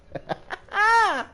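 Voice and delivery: high cackle ending on a squeal; high-pitched laugh. Real, not acted. Streamer cracking up at his own bit, high laugh. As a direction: laugh up into a high held note.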